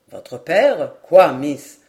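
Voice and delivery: low, loud, astonished questions; startled surprise. A narrator's reading. Lord Glenarvan to the girl at his feet, surprised. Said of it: "surpris de voir cette jeune fille".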